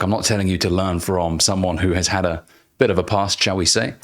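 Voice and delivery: ordinary delivery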